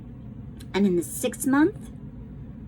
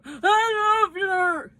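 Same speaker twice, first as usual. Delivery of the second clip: higher, slow tearful protest; weeping father; 11 semitones higher, much slower than usual. Acted. The father insists to Bryce he loved her, weeping. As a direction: say it through tears. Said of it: "as the father wept".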